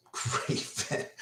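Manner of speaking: Great venue repeated as a soft whisper; whispered echo. Real, not acted. Echoing his own praise of Boston Garden, softly whispered. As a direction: breathe Great venue again to yourself.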